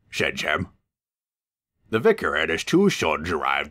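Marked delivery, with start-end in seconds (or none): none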